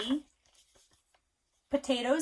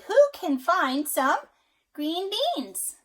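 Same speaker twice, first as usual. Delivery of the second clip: high, loud, slow hen asking; little red hen; 8 semitones higher, 10 dB louder, much slower than usual. A narrator's reading. Parent reading to child, hen asks again. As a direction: call out slowly like the little hen. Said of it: "the little red hen is going to say".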